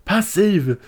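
high-pitched, hammed-up outrage; incredulous preacher. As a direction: throw it back like an overblown TV pastor. Acts preacher challenging passive Carl.

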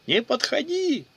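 cornered woman shrieking at the soldier; hysterical scream. A narrator's reading. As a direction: scream it in terror, voice cracking up high. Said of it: "истерично заорала женщина".

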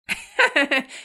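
high, hearty loud laugh. Acted. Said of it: "cười to".

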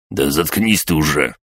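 usual speaking voice

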